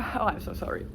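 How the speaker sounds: ordinary talk, nothing marked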